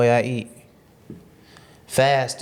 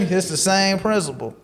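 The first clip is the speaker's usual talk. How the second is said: ordinary talk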